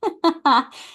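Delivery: a high, wry little laugh; short laugh. Real, not acted. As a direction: laugh off the awkward truth. Laugh after calling it toxic.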